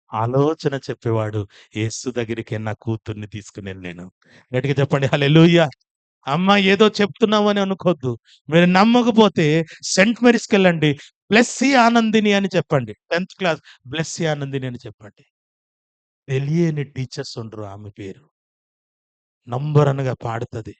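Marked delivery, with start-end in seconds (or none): 4.5-15.2 preaching at full force. Acted. Calls congregation to shout.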